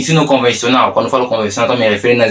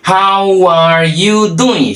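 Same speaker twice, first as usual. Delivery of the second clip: very drawn out and higher; slow demonstration; 5 semitones higher, much slower than usual. Shown as a demonstration. Teacher introduces the second way of asking, slow demonstration. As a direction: stretch every word out for learners. Teacher models phrase slowly again.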